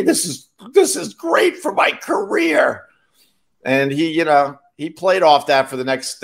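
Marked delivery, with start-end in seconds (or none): 0.0-2.9 high, slow excited comic voice. Retold or imitated. Comedian tells the guilty friend it helps his career, high and slow. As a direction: squeak it out like a thrilled comedian.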